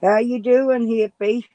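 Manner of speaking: bright, loud hello to pet; cheerful pet greeting. Real, not acted.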